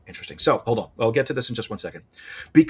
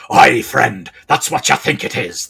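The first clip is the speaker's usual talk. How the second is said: loud, hearty pirate hare; hammed-up pirate; 7 dB louder than usual. Old hare pretending to be a pirate, hammed-up pirate voice. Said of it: "this old hare is a pirate".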